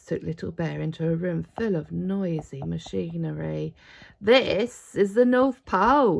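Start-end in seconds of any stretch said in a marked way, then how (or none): 4.2-6.2 loud, slow shout over machines. Acted. Father Christmas shouts over noisy machinery. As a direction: call it out over the noise. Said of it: "he shouted".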